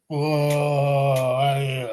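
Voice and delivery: long drawn-out wobbling "whoa"; imitated boat lurch. Drunk wrestler recalls the cabin swaying, drawn-out lurching whoa. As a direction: imitate a rolling boat with one stretched sound. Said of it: "felt like the boat was going".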